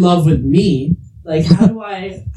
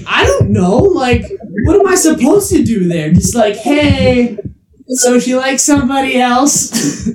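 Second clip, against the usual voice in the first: higher-pitched helpless protest; flustered retelling; 6 semitones higher, slower than usual. Retold or imitated. Retelling to cohost what he told her, flustered. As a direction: imitate being stumped, voice climbing.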